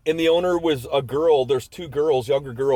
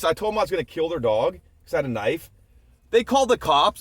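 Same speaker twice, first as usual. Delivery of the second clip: ordinary talk, nothing marked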